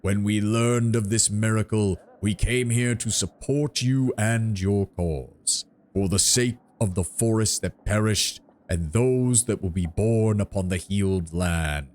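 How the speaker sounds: slow, solemn tree giant; treant voice. Acted. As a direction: draw each word out like an ancient tree. Treant's oration.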